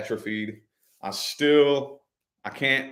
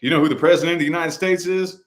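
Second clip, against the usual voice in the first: brisk, higher and louder quizzing; paramedic questioning; 5 semitones higher, 5 dB louder than usual. Retold or imitated. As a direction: fire the question like a medic checking you. Paramedics questioning him, retold.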